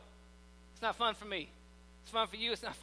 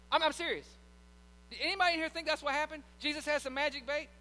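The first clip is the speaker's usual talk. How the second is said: ordinary talk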